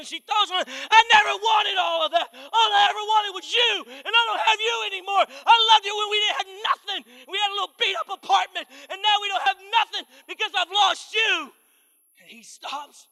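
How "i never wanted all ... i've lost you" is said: loud, high tearful outcry; bawling wife. Retold or imitated. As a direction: cry it out to him, high and loud. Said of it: "she's bawling".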